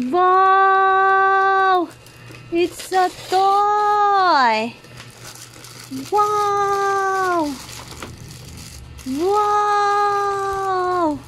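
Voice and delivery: high, long-held wows; slow, high baby-talk amazement; playful awe. Pretend. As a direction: draw it out in pretend wonder for a baby.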